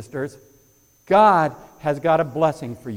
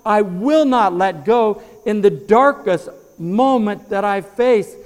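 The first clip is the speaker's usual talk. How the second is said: pleading prayer, louder and higher, slowing on I will not let go; fervent prayer; 5 semitones higher, 6 dB louder than usual. Pretend. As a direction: cry out to God and refuse to let go. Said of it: "for us to look to God, say".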